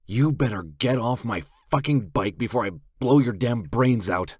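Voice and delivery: seethe through clenched teeth; menacing threat through gritted teeth; seething. A narrator's reading. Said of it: "I seethe through clenched teeth".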